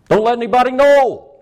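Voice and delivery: high, loud mock order; mock command. Preacher mocking repressed people's advice. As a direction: bark the motto.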